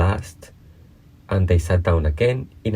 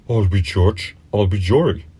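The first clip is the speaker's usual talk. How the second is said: put-on cunning old dog; 2 semitones higher than usual. A narrator's reading. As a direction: gloat like a crafty bully. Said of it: "said cunning Old Fury".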